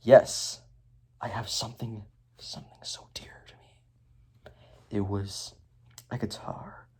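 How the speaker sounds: hushed confession sinking to a whisper; slow, whispered mock-tender reveal; Garmadon. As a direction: confide it very softly, with long pauses. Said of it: "And then Garmadon's like".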